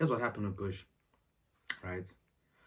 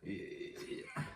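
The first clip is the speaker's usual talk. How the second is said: ordinary delivery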